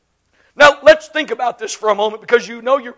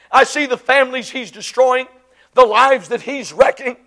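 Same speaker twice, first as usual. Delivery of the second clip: ordinary talk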